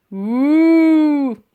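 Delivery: long loud high woo; loud mammoth hoot. A narrator's reading. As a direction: trumpet like a mammoth. Mammoth noise.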